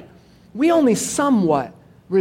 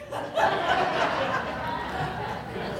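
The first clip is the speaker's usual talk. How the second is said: breathy laugh at his own punchline; a real burst of laughter at the joke; 9 dB quieter than usual. Real, not acted. Preacher laughing at his own zoo joke, breathy laugh.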